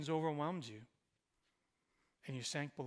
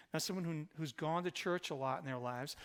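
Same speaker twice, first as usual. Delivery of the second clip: ordinary talk, nothing marked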